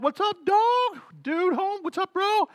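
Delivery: high, loose buddy voice; hamming up a dude greeting a friend; casual bro greeting. Retold or imitated. Mimicking friends greeting him at the door. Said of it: "they're like".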